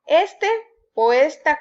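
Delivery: ESte and ESta, first syllable punched high; stress on the first syllable. Shown as a demonstration. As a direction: hit the opening E of "Este" and "esta". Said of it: "con acento en la primera E". (acento en la primera sílaba de "Este" y "esta")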